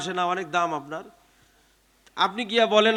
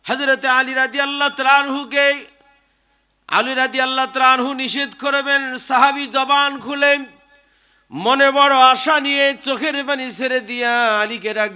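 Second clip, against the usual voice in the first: full-force preaching; 5 semitones higher than usual. Acted. Preacher hails Ali before the congregation, full-force preaching. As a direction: proclaim it to the crowd in a raised, ringing chant.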